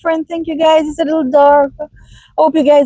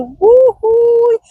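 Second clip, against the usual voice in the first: happy whoop; 10 semitones higher than usual. Real, not acted. Cheering exclamation mid-rant.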